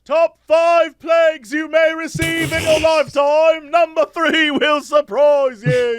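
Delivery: high, loud hype read; clickbait video narrator. Pretend.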